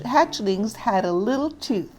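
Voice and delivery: plain reading voice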